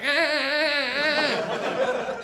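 high strained ah ah ah cries; high mock mating yowls trailing to breath; mock cat yowl. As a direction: imitate the she-cat crying out in pain. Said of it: "she was like".

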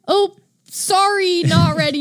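high, drawn-out imitated sorry; mock-sheepish singsong excuse; breezy apology. Acting out friend's late apology.

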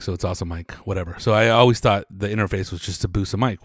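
ordinary talk

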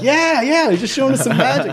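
very high, quick covering-up voice; mock eager agreement. Gang kids to sheriffs, hammed-up eager agreement. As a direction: imitate kids hastily playing innocent.

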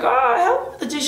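high nagging mom voice; mock a mother nagging about chores; mother nagging her daughter to do the dishes, high mock nag. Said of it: "her mom's like".

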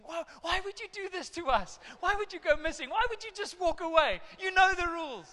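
high, loud exasperated scolding; scolding parent. Retold or imitated. Pastor scolding his own missing kids, high and loud. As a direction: tell the kid off like a worn-out parent. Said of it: "when my kids go missing".

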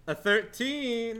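high, loud, drawn-out crow; triumphant announcement. Real, not acted. Player announcing a damage roll to the table, loud drawn-out triumph. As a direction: stretch the number out with glee.

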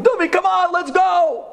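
high, loud impatient yelling; teen friends shouting for him to hurry up; friends calling out. Retold or imitated. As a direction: call him over from across the street. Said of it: "My friends were screaming, calling".

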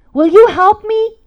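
loud, high, slow appeal; urgent plea. Retold or imitated. Mom asking her son to help her, loudly pleading. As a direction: plead out loud, stretching the words.

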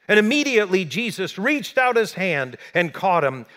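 fired-up sermon delivery; preaching at full force. Acted. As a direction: preach it out to the room. Preaching scripture story.

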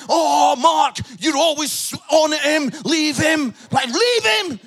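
high, loud pleading protest; imitating a wife telling her husband to back off; exasperated wife. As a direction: build to an exasperated shout. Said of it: "my wife's like".

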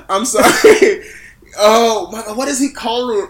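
loud, high, tearful laughter; helpless crying laughter; laughing to tears. Real, not acted.

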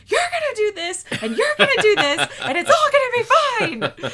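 high, loud mock freak-out; Cindy freak-out. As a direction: mimic someone losing it, loud and very high. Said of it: "Cindy has a little freak out".

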